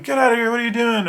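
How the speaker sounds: high, fast, loud alarmed yell; pilots yelling. Retold or imitated. Pilots shouting at the dreamer who kicked in the door, alarmed yell. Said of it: "the pilots are in there going".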